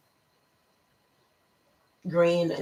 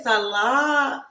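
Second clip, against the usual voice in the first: normal talking voice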